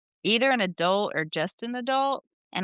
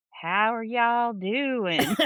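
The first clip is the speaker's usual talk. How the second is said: very slow, stretched Texas drawl; country Texas accent; 3 semitones higher, much slower than usual. Retold or imitated. Came back from Texas, exaggerated country accent. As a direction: draw it right out in hammed-up Southern twang. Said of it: "the more country you are".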